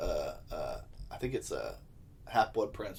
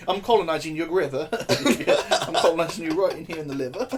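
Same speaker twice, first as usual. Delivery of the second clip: mock British stabber; 6 semitones higher, 5 dB louder than usual. Mocking stabbing Brit, laughing.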